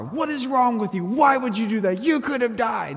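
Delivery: high-pitched, exasperated yelling; yelling angrily. Retold or imitated. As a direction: re-enact scolding kids from behind the wheel. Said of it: "I start yelling in the car".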